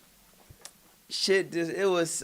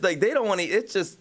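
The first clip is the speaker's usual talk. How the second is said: ordinary delivery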